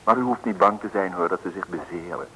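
usual speaking voice

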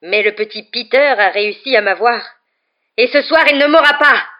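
loud, building to a high shout; angry vow, climbing to a yell; growling anger. A narrator's reading. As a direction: growl it, rising into fierce defiance. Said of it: "grogna-t-il".

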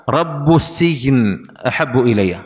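"رب" slowly said rabbu; variant with damma. Shown as a demonstration. Teacher quoting a variant recitation, "رب" slowly said rabbu. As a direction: link "رب" to "السجن" with an u. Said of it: "وقرئ".

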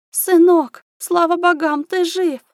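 high, loud cry of a frantic mother; countess's panicked high-pitched call; frightened cry. A narrator's reading. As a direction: cry out high in alarmed relief. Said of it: "послышался испуганный крик графини".

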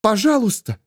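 high-pitched plea; urgent one-word entreaty; pleading woman. Acted. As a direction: beg high, falling at the end. Patience begs Ravenor.

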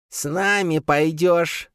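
high, slow grating creature. A narrator's reading. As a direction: grind out the order. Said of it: "заскрежетала она".

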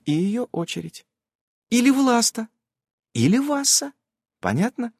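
high, lilting explainer; high-voiced magic companion. A narrator's reading. Nasa checks the player understood, in a high voice. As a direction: list them high, then check.

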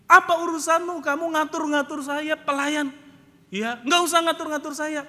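loud, high, scornful tirade; Pak Mukidi. Retold or imitated. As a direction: put the servant in her place angrily. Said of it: "Pak Mukidi marah-marah". (mengomel marah, suara tinggi dan keras)

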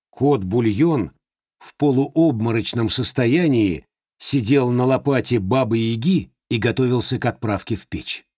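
ordinary delivery, nothing marked

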